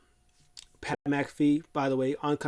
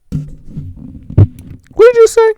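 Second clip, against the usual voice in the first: much higher, much louder yell; furious shout; 18 dB louder, faster than usual. Retold or imitated. Brock yelling at Heyman, hammed-up furious shout. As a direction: explode at him.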